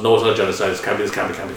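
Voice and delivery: ordinary talk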